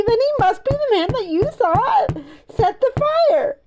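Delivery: high-pitched breakthrough exclamation; excited cry. A narrator's reading. Benny works out who set the fire, high excited cry. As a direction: blurt the discovery, voice way up.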